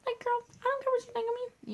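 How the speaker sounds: high, carefree diva voice; sassy girl voice. Retold or imitated. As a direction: toss it off like a girl who doesn't care. Mimicking the outgoing friend.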